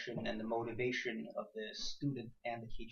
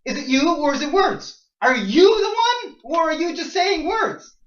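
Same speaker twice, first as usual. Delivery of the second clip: rising from firm to near-shouting, higher and louder; building passionate challenge; 13 semitones higher, 14 dB louder than usual. Real, not acted. Rhetorical climax to the class.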